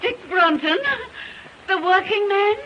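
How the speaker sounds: high, loud, scandalized questions; appalled mother, pitch leaping up; shocked disbelief. Acted. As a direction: exclaim it in horrified disbelief. Mother hears of daughter's engagement.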